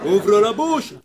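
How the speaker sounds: loud firm command; firm order. A narrator's reading. Dentist ordering the clenching boy, loud.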